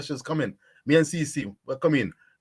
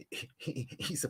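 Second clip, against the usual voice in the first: whisper; 2 semitones lower, 14 dB quieter than usual. Real, not acted. Unvoiced whisper.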